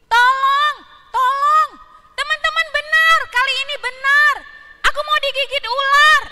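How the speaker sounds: high, loud fake cry for help; parrot crying for help. Parrot fakes a call for help to its friends, high loud shout. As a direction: shriek for help like a tricky parrot. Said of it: "beo mengulangin lagi".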